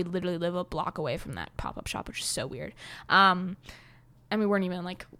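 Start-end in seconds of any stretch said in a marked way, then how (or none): 0.0-2.7 breathy whisper of a private fact; whispered aside. Real, not acted. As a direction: whisper it like a secret. Aside about living nearby.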